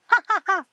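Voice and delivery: high cackling villain laugh; maniacal laugh. Retold or imitated. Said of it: "the caterpillar's just maniacally laughing".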